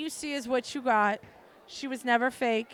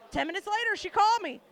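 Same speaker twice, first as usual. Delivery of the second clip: usual speaking voice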